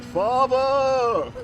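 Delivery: high, loud, booming cry of "Father!"; hammed-up theatrical bellow of "Father!"; bellowed Matt Berry cry. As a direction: storm into the funeral with one grand dramatic yell. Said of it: "He's like".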